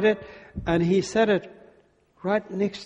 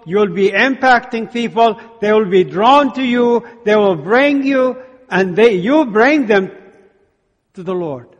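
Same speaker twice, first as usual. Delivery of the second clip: louder preaching build; rising sermon; 4 semitones higher, 6 dB louder than usual. Acted. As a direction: push each line harder to the congregation. Preaching at full force.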